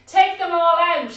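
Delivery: put-on loud, firm wise old man; firm command. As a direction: order it with authority. Wise man's firm order.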